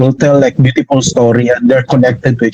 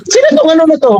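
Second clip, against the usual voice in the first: mock scolding; 13 semitones higher, faster than usual. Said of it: "Pinagalitan ako nun".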